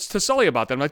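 ordinary talk

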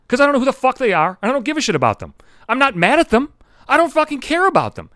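worked-up insistence, pitch climbing; retold heated argument. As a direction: re-enact arguing, high and punchy. Acted heated argument with grandma.

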